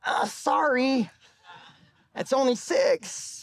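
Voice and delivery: lightly voiced consultant, dragging it out; consultant's reply. Consultant voiced in retold story.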